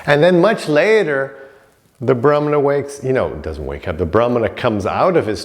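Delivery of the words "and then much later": usual speaking voice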